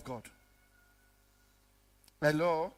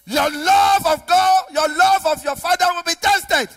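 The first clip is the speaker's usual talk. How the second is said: high, loud pulpit cry; full-force preaching; 10 semitones higher, 12 dB louder than usual. Real, not acted. Preacher charging the congregation over zeal for God, full-force preaching.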